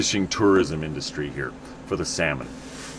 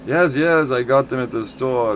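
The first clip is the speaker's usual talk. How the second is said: higher, imitated German accent; 5 semitones higher, 3 dB louder than usual. German tourist answering about shoes, mock German accent. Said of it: "you'll meet these German guys".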